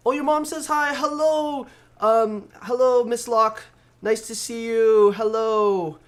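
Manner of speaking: high, loud, beaming greeting; excited welcome. Real, not acted. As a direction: welcome her like a party host. Greeting friend's mom on camera.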